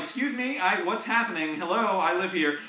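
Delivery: timid high complaint; high polite protest. Retold or imitated. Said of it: "I'm like".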